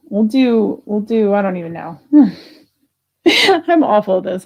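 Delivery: ordinary talk, nothing marked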